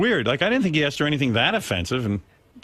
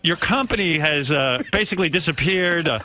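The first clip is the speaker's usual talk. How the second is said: official announcement voice; 5 semitones higher than usual. Pretend. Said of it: "Hey, guys".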